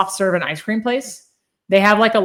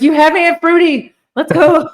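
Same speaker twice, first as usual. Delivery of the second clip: excited; 10 semitones higher, 8 dB louder than usual. Retold or imitated. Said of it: "I was like".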